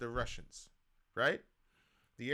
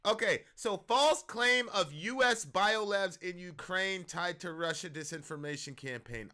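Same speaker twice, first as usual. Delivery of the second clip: ordinary talk, nothing marked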